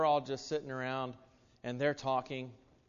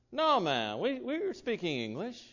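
put-on Jamaican accent; 8 semitones higher, 5 dB louder than usual. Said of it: "He says".

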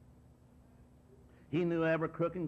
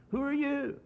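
higher, louder indignant "Who are you?"; indignant shout; 10 semitones higher, 4 dB louder than usual. Retold or imitated. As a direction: fling the question back in scorn. Brethren challenging Moses.

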